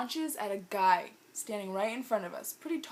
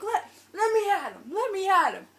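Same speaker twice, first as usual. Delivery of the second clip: fired-up mock yelling; 10 semitones higher, 8 dB louder than usual. Friend held back by her boyfriend, mock yelling. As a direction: yell it like you're being held back.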